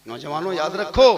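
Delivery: ordinary talk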